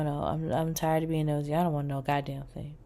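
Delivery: ordinary talk, nothing marked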